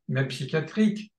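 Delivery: ordinary talk, nothing marked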